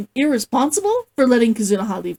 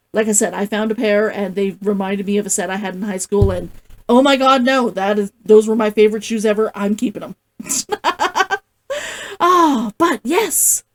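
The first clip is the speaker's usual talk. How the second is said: usual speaking voice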